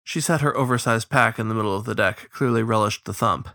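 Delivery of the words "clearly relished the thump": ordinary delivery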